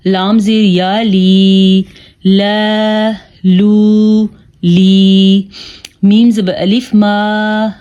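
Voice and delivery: لا، لو، لی chanted with long held vowels; stretched long vowels. Shown as a demonstration. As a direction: hold each final vowel long and even, as in نا، نو، نی. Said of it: "ہم نے ان کو کھینچ کے پڑھا". (کھینچ کے پڑھنا)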